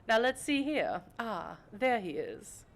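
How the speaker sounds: lightly voiced genteel mentor to a young vampire; a hint of a refined patron's voice; elegant vampire patron. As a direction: instruct your protégé, poised and measured.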